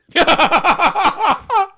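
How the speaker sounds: high, loud real laughing burst; loud burst of laughter. Real, not acted. Stunned silence breaks into laughter.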